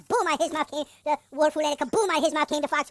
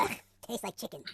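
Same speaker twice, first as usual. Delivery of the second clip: ordinary delivery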